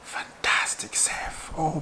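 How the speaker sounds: slow hushed praise; awed whisper. Real, not acted. Fan remembering a great save, slow awed whisper. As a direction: breathe it out in admiration.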